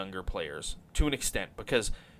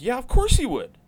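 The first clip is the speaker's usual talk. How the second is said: pitched way up, louder, dripping sarcasm; sarcastic agreement; 14 semitones higher, 7 dB louder than usual. Retold or imitated. Host mocking his online critics, high and sarcastic. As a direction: toss it back with exaggerated mock agreement. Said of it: "And I'm like".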